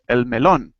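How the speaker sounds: El melón, weight on the final LÓN; stress on melón. Shown as a demonstration. Teacher demonstrates El melón, stressing LÓN. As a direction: model El melón, pressing LÓN. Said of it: "make an emphasis on that part of the word".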